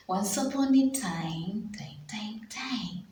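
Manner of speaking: whispered story opening, fading away. Shown as a demonstration. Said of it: "storyteller whisper".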